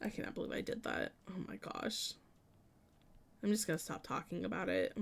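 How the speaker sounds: quick whisper to herself; embarrassed whisper. Real, not acted. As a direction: whisper it, mortified. Embarrassed at own mistake.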